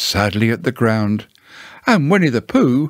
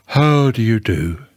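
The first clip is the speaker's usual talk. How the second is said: gloomy. A narrator's reading. Eeyore greeting Winnie the Pooh, gloomily. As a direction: greet them with heavy gloom. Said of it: "in a gloomy manner to him".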